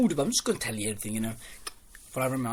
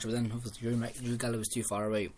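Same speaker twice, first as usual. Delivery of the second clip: ordinary talk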